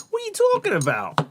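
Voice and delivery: very high mock New York accent.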